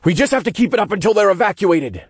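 fast, high-pitched urgency; urgent explaining. A narrator's reading. Hero explaining the evacuation plan, urgent. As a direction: rattle it out under pressure.

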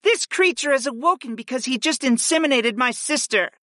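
high-pitched indignant complaint; huffy. A narrator's reading. Fi blames Griff for waking the creature, huffy. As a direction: huff it in a high character voice. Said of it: "Fi huffed".

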